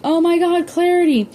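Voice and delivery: put-on high, frightened cry of the baby's name; screaming for the baby in panic; panicked scream. A narrator's reading. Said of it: "Gloria started screaming".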